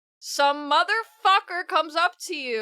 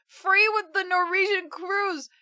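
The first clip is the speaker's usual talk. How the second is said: higher, amused surprise; surprised reread; 7 semitones higher than usual. Real, not acted. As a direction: reread it, amazed it's a cruise.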